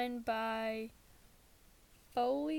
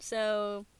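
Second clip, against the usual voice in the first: ordinary talk, nothing marked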